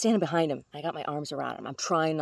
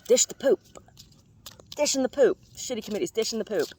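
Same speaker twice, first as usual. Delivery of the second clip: talking to the dog; 8 semitones higher, 6 dB louder, faster than usual. Real, not acted. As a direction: coax the pup playfully, high and quick.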